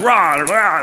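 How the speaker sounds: high imitated lion roars; mock roaring. Simba tackles the lion and they fight, mock roaring. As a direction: play two roars for a story fight.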